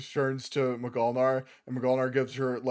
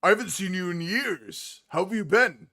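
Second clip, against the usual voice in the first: warm gruff greeting; 5 semitones higher, 4 dB louder than usual. Acted. McGulnar greets old friend.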